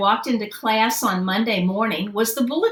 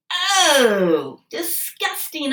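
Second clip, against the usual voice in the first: disgusted; 6 semitones higher, much slower than usual. Acted. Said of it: "I said".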